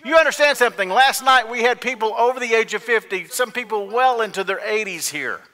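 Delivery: high, shouted sermon questions; full-force preaching. Real, not acted. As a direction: drive it home to the congregation at the top of your voice.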